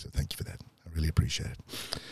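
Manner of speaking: soft breathy whisper; whispered thanks. Real, not acted. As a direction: whisper it gently to her. Soft thanks to his ex-partner.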